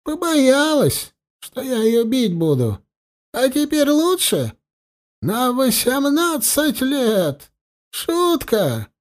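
high, slow trembling bitter voice; a wry old man's quavering voice. A narrator's reading. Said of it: "дребезжащий голос". (дребезжащий горький голос)

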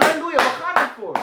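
high, loud rallying cry; motivating cheer. Shown as a demonstration. Preacher demoing a cheer to motivate others, high and loud. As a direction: fire them up. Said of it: "je motiveert jezelf en anderen".

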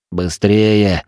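usual speaking voice

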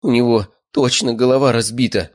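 mild startled exclamation; испуганный вскрик. A narrator's reading. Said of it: "вскрикнула девушка". (лёгкий девичий вскрик)

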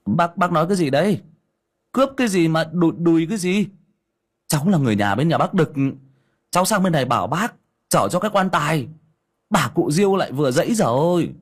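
put-on a quick bewildered young nephew; confused young man. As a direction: rattle it off, puzzled.

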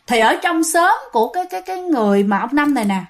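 normal talking voice

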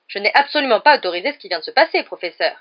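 loud indignant self-defence. A narrator's reading. Percy defends himself to the professor, indignant. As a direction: protest your innocence firmly. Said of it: "d'un air indigné".

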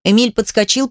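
plain reading voice

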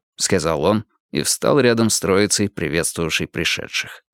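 usual speaking voice

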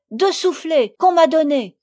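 high, loud wail of complaint; crying out. A narrator's reading. Sophie complains to her stepmother about the slaps, crying out. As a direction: cry it out loudly in protest. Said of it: "Sophie criant".

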